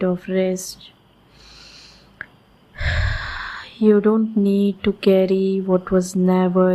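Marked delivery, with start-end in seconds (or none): 2.8-3.6 slow exhaled sigh of release; long soft sigh. Acted.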